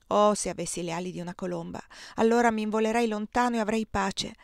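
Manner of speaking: ordinary delivery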